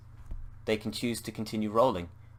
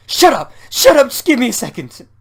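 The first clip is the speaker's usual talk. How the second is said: shrieking, much higher and louder; screaming; 17 dB louder than usual. Acted. Anne screaming at Bob to shut up. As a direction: scream them down in a frenzy. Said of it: "interrupted by Anne screaming".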